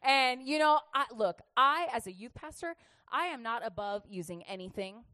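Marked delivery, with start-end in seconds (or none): none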